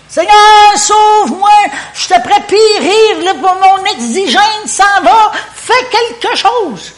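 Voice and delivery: high, loud, slow desperate plea; frantic shout to the Lord, drawn out and high; panicked cry for help. Acted. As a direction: cry out like a man sinking in the storm. Acted desperate cry of sinking Peter.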